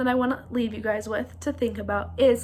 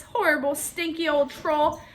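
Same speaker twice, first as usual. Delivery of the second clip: usual speaking voice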